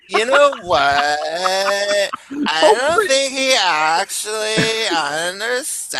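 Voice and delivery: put-on slow, stretched-out SoCal girl accent; Southern California female accent. Showing off the accent to the stream, Southern California female accent. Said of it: "wait, no, I'll do it".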